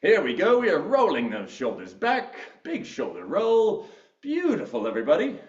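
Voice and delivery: swooping British fitness instructor; lively mock-British workout cues; British accent. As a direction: coach the class in a put-on British accent. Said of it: "I'm gonna stop with my British accent".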